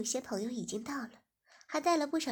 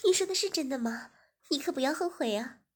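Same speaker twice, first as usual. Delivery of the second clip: louder, higher, thrilled guest; excited young woman; 3 semitones higher, 7 dB louder than usual. Acted. Guest Ziying thrilled by the wine cellar, louder and higher. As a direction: squeal with delight at the offer.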